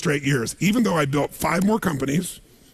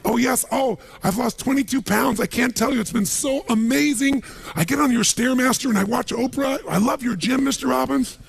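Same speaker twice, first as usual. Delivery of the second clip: higher, thrilled maid voice; gushing maid; 6 semitones higher than usual. Retold or imitated. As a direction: play the maid gushing to the boss. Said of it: "my maid, she's back at home in the castle going".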